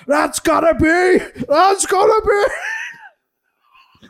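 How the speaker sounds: loud, high, slow dramatic shout; hammed-up shout. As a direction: belt the hype line, hammy. Quoting the video package.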